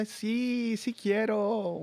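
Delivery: slow, high hammed-up Mexican Spanish accent; mock Mexican accent. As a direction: drawl it out in a jokey Mexican accent. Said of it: "you do the Mexican".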